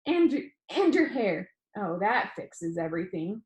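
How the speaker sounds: lively first-person read-aloud to a class; story read aloud. A narrator's reading. Teacher reading the class novel aloud, lively narration. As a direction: read the chapter to the students.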